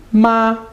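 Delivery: MA high and level; high flat-pitched MA, no bend; first tone. Shown as a demonstration. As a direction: hold MA steady on a high note. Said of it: "first tone".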